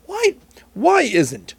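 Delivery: usual speaking voice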